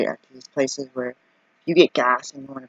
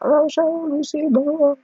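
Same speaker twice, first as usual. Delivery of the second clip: mock mom; 13 semitones higher, slower than usual. Mom reacting to singing.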